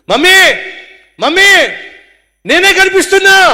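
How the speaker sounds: put-on high, loud little girl's voice; excited little girl. A little girl calling to her mother, high and loud. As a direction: squeal it like a delighted small child.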